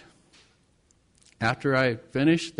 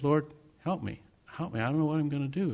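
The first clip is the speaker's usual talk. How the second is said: soft, despairing plea to God; quiet prayer; 7 dB quieter, faster than usual. Retold or imitated. Pastor alone praying for help, soft and despairing. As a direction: murmur a helpless prayer. Desperate prayer after the visit.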